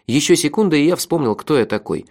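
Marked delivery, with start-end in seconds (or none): none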